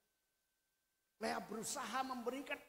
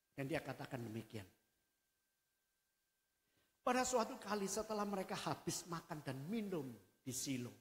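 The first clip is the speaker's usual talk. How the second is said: ordinary delivery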